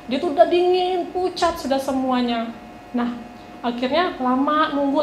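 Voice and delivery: usual speaking voice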